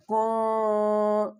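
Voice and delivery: کن with the noon half hidden and nasal. Shown as a demonstration. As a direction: soften the noon in کن into a light nasal. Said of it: "یہاں پر اخفا کرنا ہے". (کن میں نون کا اخفا)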